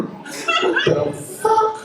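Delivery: high, very slow, laughing disbelief; stunned disbelief. Retold or imitated. Comedian spots his girl beside his homeboy, slow laughing disbelief. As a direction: stretch it out, can't believe it.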